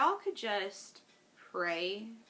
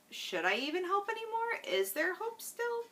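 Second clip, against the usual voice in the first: much higher, questioning inner voice; doubting inner voice; 11 semitones higher than usual. Retold or imitated. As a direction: voice the doubt as a thought to herself. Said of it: "when I'm like".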